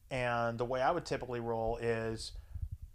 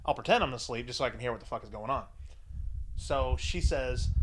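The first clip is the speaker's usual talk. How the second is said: usual speaking voice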